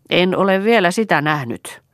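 stern. A narrator's reading. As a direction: answer sharply, with mild sternness. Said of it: "vastasi Jaakko jyrkällä äänellä". (jyrkällä äänellä)

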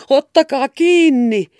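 loud, high, slow shout after a thief. A narrator's reading. Old peddler shouting after a fleeing boy. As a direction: yell it out, drawn out. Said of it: "Äijä huusi".

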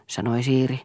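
usual speaking voice

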